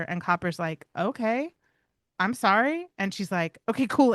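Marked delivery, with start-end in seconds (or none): 1.0-2.9 lightly raised, apologetic dog voice; a hint of a sheepish dog saying sorry; voicing Copper. Owner voicing the other dog backing down, lightly raised apologetic voice. 3.7-4.1 higher, louder little dog voice; voicing Miley. Owner voicing her dog after the apology, higher louder dog voice.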